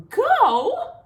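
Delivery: sudden high, loud shocked cry; cygnets cry out. A narrator's reading. Cygnets shocked by the news, crying out high and loud. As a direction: yelp it like startled baby swans. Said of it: "cried all the cygnets".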